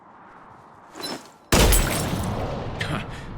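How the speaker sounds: big, loud gloating laugh. Acted. Garrus mocking Shepard's shooting with a loud laugh. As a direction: laugh out loud at the rival's shot.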